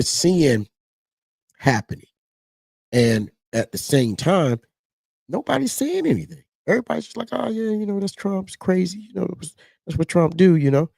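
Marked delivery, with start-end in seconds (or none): 5.3-10.9 high, mock-casual shrug voice; mocking the indifferent. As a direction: imitate people brushing it off. Mocking people's casual dismissal.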